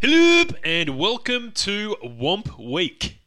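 loud, slow hosting voice; announcer intro. Acted. Host opening the episode, booming announcer. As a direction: welcome listeners like a radio host. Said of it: "show intro".

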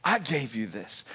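a hint of God's voice, quiet and low; lightly voiced God. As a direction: speak softly and low as God, unhurried. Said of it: "This is God saying".

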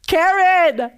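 high, loud, delighted shout of her name; thrilled shout of recognition; excited cry of recognition. Retold or imitated. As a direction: call out her name in joyful surprise. Recognizing mentor in the room.

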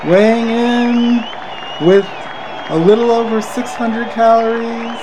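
booming boxing ring announcer, drawn-out words; Michael Buffer impression. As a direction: introduce the fighter slowly, stretching every name. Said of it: "I'm trying to do Michael Buffer".

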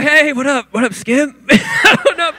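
high-pitched mock-casual greeting, breaking into laughter; failed attempt at calm. Starstruck teen greets basketball idol, failing to act calm. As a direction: play it cool and badly fail. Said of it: "I'm trying to be calm".

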